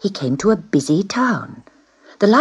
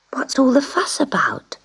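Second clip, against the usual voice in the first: high whisper to the neighbour; a kitten's hushed high whisper; whispered question; 8 semitones higher than usual. Acted. Kitten asks cat next door.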